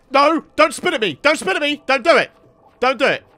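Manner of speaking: nervous, loud pleading; begging it not to spit; panicked pleading. Real, not acted. Llama about to spit.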